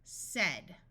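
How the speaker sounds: "Said" said like "sed"; "Said" rhyming with "bed"; short E in "Said". Shown as a demonstration. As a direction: give "Said" an eh vowel. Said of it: "with a short E sound".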